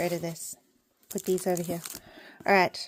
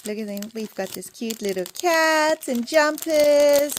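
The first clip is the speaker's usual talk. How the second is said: ordinary talk, nothing marked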